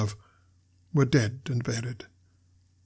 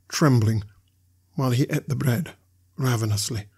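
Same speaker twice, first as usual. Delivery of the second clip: plain reading voice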